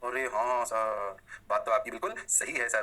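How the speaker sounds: a very slow, drawn-out student voice agreeing with sir; hammed-up slow student agreeing; mock student drawl. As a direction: stretch it out like a lazy pupil saying yes. Teacher voices student agreeing slowly.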